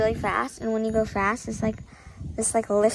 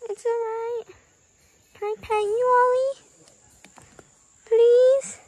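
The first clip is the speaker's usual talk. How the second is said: high coaxing pet voice; sweet high voice for a scared dog; 12 semitones higher, 7 dB louder than usual. Real, not acted.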